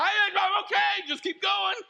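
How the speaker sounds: high, loud mock-panicked shout; hammed-up frantic reassurance; freaked out. Freaked-out passenger to driver, hammed-up panicked insistence. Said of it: "you're all freaked out".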